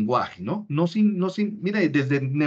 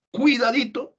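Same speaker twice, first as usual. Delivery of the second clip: high, sing-song Cuidadito; warning cuidadito; 7 semitones higher than usual. Shown as a demonstration. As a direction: warn a child to stop, pitched up. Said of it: "palabras que permitan detener la conducta".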